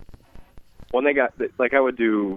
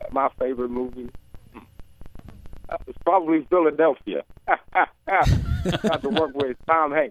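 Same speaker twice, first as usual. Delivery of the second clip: higher-pitched actor impersonation with a chuckle; Denzel Washington; 6 semitones higher than usual. Acted. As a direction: muse in character, then laugh and draw it out. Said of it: "your favorite of your, of your movies, Denzel?".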